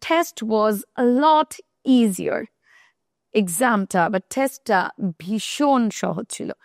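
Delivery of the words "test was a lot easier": drawn out, leaning on lot; very slow example. Shown as a demonstration. Teacher reading an example to students, very slow. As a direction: stretch the sentence out for learners. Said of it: "যেরকম আমি যদি বলি".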